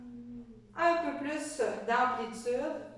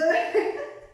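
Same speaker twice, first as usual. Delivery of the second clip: burst of laughter; 10 semitones higher, 5 dB louder than usual. Real, not acted. Child climbs on mom.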